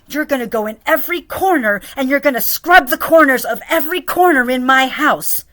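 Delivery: loud, high mocking boss voice; mock her nagging orders; hammed-up demanding boss; bossy employer. Imitating boss demanding scrubbing.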